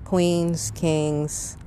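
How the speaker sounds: ordinary talk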